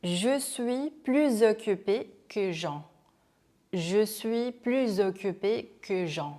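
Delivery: slow French, "plus" linked as "plüz" into "occupé"; measured teacher's demo, Z linking "plus occupé"; liaison model. Shown as a demonstration. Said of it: "연음이 되는 경우죠".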